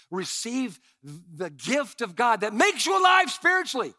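ordinary delivery

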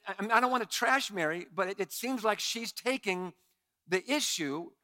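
ordinary talk

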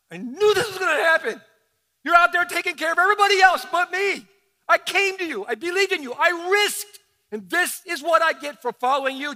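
loud, high, accusing cry; a bereaved father shouting his anger; angry grief. Retold or imitated. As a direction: rage at Jesus through grief. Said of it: "oftentimes anger comes up".

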